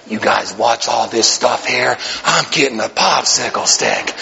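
a hint of a mob boss toddler; lightly voiced toddler boss. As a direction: toddler ordering his gang, low and slow. Toddler gang leader voice.

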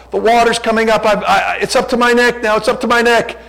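high, loud panicked cry about rising water; panicked caller. Retold or imitated. Pastor imitating a drowning caller in an ad, panicked. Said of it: "they played the audio".

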